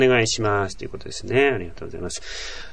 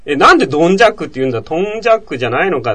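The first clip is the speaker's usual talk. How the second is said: 1 retold puzzled question; 5 semitones higher, 5 dB louder, faster than usual. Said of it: "って聞いたら".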